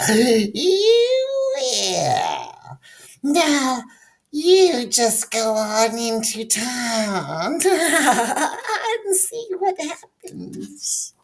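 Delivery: very high, slow laughing witch. A narrator's reading. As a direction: cackle the words like a witch. Witch character laughing through speech.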